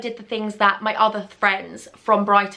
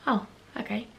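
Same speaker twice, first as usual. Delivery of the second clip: whisper; 9 dB quieter than usual. Retold or imitated. Boyfriend's reaction, whispered.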